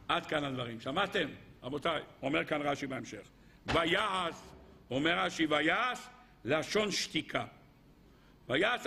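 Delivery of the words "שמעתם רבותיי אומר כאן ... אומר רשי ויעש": usual speaking voice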